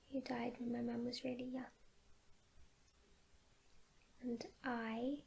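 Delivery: soft, near-whispered revelation; hushed, sombre. Acted. Girl recounting how dad told her of a dead aunt, hushed. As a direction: drop to a hush, with pauses. Father reveals the aunt's death.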